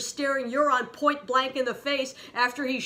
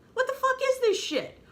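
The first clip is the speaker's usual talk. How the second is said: much higher, indignant and fed up; exasperated. Real, not acted. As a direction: demand an answer, fed up. Outraged disbelief at a plot twist.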